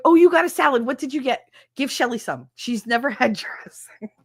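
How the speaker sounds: high, eager nosy pestering; mock over-eager, starting loud; acted excitement. Acts out excited begging for friends' dressing.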